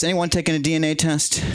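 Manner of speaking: usual speaking voice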